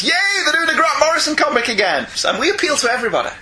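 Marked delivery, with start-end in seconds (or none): 0.0-2.1 higher, gleeful fan voice; mock cheering fan. Host imitating listeners delighted by Grant Morrison, mock cheering. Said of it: "very large segment of the listening population".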